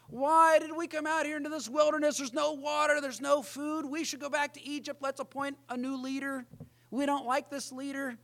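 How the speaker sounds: high, whiny complaining voice; grumbling Israelites. Retold or imitated. Preacher voicing Israel grumbling in the wilderness, high and whiny. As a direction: whine like a crowd fed up in the desert. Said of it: "grumble, like Israel in the wilderness".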